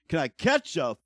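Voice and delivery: fed up, begging for a break; exasperated outburst. Real, not acted. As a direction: cry out for a break. Venting about wife's hospital stay.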